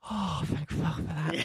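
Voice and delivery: soft, slow sigh of relief, retold; relieved aside. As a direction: let it out quietly, hugely relieved. Group's retold relief at being let off.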